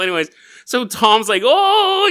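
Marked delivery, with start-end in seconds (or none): 1.4-2.1 high, loud goofy "oh"; dopey high-pitched exclamation; goofy science teacher. Acted. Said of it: "he's all goofy".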